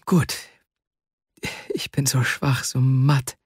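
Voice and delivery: mildly breathless, with long halting pauses; struggling for breath. A narrator's reading. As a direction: weakly gasp it out, understated. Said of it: "um Atem ringend". (leicht um Atem ringend)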